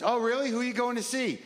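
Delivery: chirpy high curiosity, barely a character; curious customer. Retold or imitated. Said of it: "the guy ordering coffee goes".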